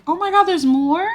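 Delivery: high-pitched surprised question. Real, not acted. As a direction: voice jumps up in disbelief.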